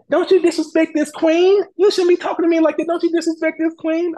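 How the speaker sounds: hammed-up outrage, demanding respect; mock angry queen. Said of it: "she got mad".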